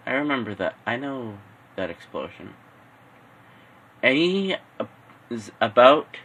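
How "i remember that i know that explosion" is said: inner thought. Acted. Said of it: "in the back of his head he thought".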